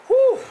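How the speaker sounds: high, loud exhale of relief; loud relieved whew. Real, not acted. Streamer relieved after the match ends, loud whew. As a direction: blow out a big whoosh of relief.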